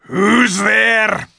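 high, loud shouted wolf challenge; growled warning. A narrator's reading. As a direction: bark it out like a guard wolf. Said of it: "said in a growling voice".

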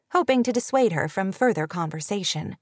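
ordinary delivery, nothing marked

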